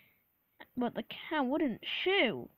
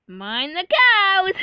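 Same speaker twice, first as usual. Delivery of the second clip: long, high, very loud call, then laughter; hammed-up bellow with a big cackle; roaring shout; 7 semitones higher, 14 dB louder, much slower than usual. A narrator's reading. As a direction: holler it slowly and burst out laughing. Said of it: "as he roared along the line".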